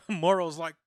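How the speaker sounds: ordinary talk, nothing marked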